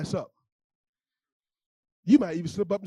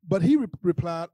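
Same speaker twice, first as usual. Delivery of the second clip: ordinary talk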